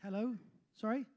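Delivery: ordinary talk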